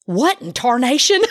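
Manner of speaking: shocked. Retold or imitated. Woman seeing his photo for the first time, shocked. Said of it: "I was so shocked".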